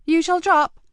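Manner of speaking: a cheeky lad asking keenly; young boy voice. A narrator's reading. Mickey asks Marcus eagerly, in a high young boy's voice.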